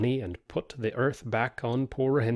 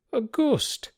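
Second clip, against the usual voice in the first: pitched high, incredulous question; can't believe their ears; startled disbelief; 13 semitones higher than usual. Acted. Men in the tavern doubting the frightened man, incredulous high.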